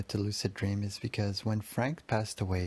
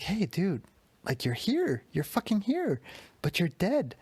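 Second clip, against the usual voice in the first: much higher, disbelieving outburst; can't believe he's here; seeing his dead cousin again, overwhelmed; stunned excitement; 9 semitones higher than usual. Retold or imitated.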